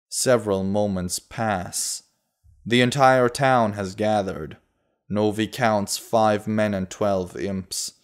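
usual speaking voice

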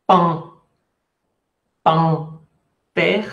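"Pan, terre" split with a pause between syllables. Shown as a demonstration. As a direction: separate nasal "Pan" from "terre". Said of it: "Hice la separación en sílabas".

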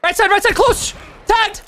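loud, high-pitched urgent shouting; shouted callouts. Real, not acted. Player calling enemy positions to teammates, urgent shouting. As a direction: shout it to your squad in a firefight.

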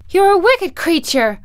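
put-on high, loud little-girl outburst; Dorothy's loud, high accusation; angry cry. A narrator's reading. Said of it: "cried Dorothy".

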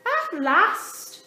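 high, loud, laughing little penguin; Dudley, fed up. A narrator's reading. Dudley complains to his family, high and loud. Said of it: "said Dudley".

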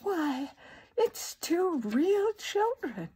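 put-on high, slow, marvelling old lady; astonished. A narrator's reading. Old lady peers at two children, astonished. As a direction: draw it out in wonder. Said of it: "She sounded as surprised".

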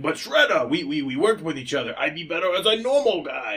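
put-on pleading, loosely Russian accent. Henchman begging Shredder to spare him, Russian accent. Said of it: "the Russian guy's like".

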